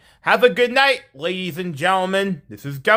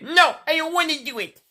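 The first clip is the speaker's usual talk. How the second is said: higher-pitched mock protest; mimicked refusal; 7 semitones higher than usual. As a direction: imitate a student digging in. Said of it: "she wanted to say".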